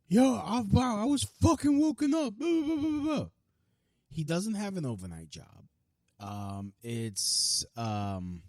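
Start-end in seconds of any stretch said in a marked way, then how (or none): 0.1-3.3 high, whiny mocking voice of the neighbor; squeaky mocking whine. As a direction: mimic him complaining about waking up.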